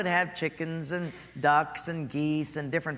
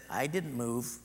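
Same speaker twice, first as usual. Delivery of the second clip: dry husband reply; 5 semitones lower, 6 dB quieter, slower than usual. Retold or imitated. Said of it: "Harold looked at her and said".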